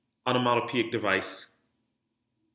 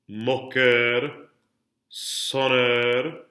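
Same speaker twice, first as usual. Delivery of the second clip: "Senteur, orgueil, moqueur" with long, weighted final syllables; "vapeur, rigueur, rondeur" one at a time, motion carried to the last syllable; last-syllable emphasis; 3 dB louder, much slower than usual. Shown as a demonstration. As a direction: model each word from "Senteur" to "rondeur" for repetition, stretching the ending. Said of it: "putting all that emphasis on the last syllable".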